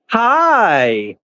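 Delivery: high, sing-song coaxing call to an animal; man calling his favorite donkey over in a high pet voice; pet-talk voice. Real, not acted. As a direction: coo at the donkey to lure it closer.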